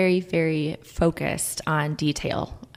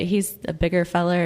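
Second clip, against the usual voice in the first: usual speaking voice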